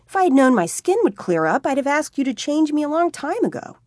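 put-on young man gushing he'd have turned sooner; excited Tommy. A narrator's reading. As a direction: gush about it happily. Tommy excited about change.

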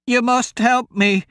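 put-on high, loud old woman's cry; an old woman calling for help from the brush; old woman shouting. Old woman shouts to the travellers for help. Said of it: "the old woman shouted".

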